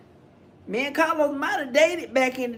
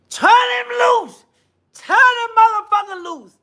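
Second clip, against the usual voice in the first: mock wailing shout; 10 semitones higher, 4 dB louder than usual. Host parodies the aunt's plea with a wailing yell. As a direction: wail it as a parody of the aunt.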